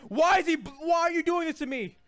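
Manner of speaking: high, loud wail of complaint; dismayed protest. Real, not acted. Complaining under attack in game.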